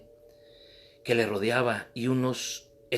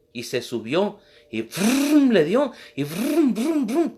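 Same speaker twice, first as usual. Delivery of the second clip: rising, louder engine brum; motorcycle brum; 8 semitones higher, 6 dB louder, slower than usual. Retold or imitated. Preacher imitating the motorcycle engine, rising and loud. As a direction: rev it up with your voice.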